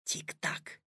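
soft secret whisper; hushed, voiceless murmur. A narrator's reading. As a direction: whisper close over the clock. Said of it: "добавил шёпотом".

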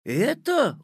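high, astonished one-word question; surprised question. A narrator's reading. Petya staring at his school building, surprised. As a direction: let the pitch leap up in surprise. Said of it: "удивился Петя".

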